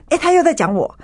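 surprised. Retold or imitated. Finding chapter two about her.

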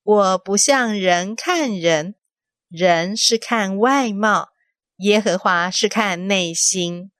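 slow, weighty voice of God; slow solemn quote. Acted. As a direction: spell it out slowly and gravely. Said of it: "那句话就是".

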